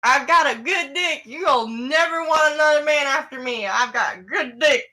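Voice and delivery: loud, high mock come-on; drunk man's boast. Drunk passenger propositioning the women, mock loud boasting. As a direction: imitate him bragging at full voice.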